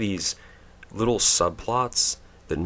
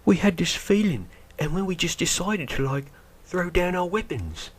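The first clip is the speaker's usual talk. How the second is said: much higher, silly voice; mock stormtrooper; 12 semitones higher than usual. Mocking the ex-stormtrooper's story in a silly high voice. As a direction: parody the earnest defector. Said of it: "and he's like".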